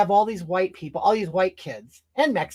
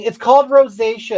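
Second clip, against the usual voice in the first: louder, higher insistence on the name; loud correction; 4 semitones higher, 8 dB louder than usual. Real, not acted. Correcting a viewer about her red skin, loud and firm. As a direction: set them straight, loud.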